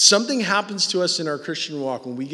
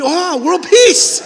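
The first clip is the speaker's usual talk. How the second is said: mock blissful; 12 dB louder, much slower than usual. Imagined result of silence.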